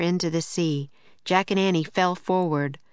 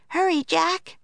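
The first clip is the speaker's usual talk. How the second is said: put-on high-pitched girl's voice; urgent call, pitched well up; Annie crying out; 11 semitones higher than usual. A narrator's reading. Annie calling from the treehouse window, high cry. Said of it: "cried Annie".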